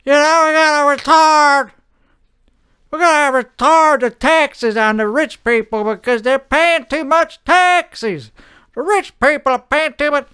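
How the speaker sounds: pontificate like a stuffy official; mock pompous politician. Politician arguing for tax cuts on the rich, pompous. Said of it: "Like if you say".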